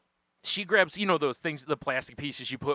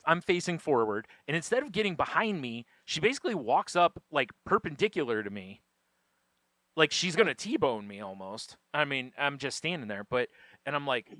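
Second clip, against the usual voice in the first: usual speaking voice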